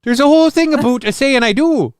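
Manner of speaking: high, exaggerated Minnesotan vowels; Minnesotan accent. Host explaining the vows for laughs, mock Minnesotan accent. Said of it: "a little bit of Minnesotan".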